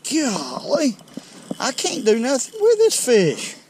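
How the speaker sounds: high-pitched cry of struggle; overwhelmed angler's high outburst; real exasperated exclamation. Real, not acted. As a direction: exclaim it high, mid-fight with a fish. Struggling to land a big fish.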